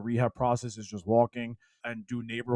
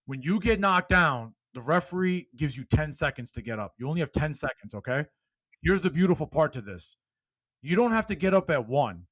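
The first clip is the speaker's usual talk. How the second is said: loud, high motivational speech; fired-up preaching; 4 semitones higher, 4 dB louder than usual. Acted. Speaker rallying podcast listeners, loud preaching. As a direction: preach it to the whole room.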